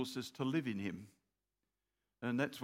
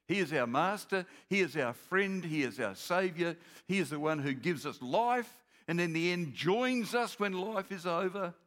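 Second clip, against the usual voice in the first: louder, building preacher's proclamation; full preaching; 3 semitones higher, 5 dB louder than usual. Acted. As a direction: proclaim each line to the church.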